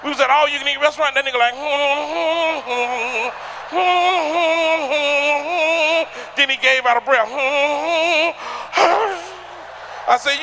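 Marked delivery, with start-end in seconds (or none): 1.6-6.3 humming groan while eating; drawn-out eating hum. Retold or imitated. Heavy eater's noise. 7.3-10.0 big gasping breath. Retold or imitated. Heavy eater's noise.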